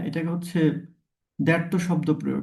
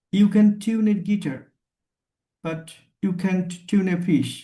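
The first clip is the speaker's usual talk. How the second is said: slow example pun; much slower than usual. Shown as a demonstration. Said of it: "মনে হচ্ছে কি যে tune a fish".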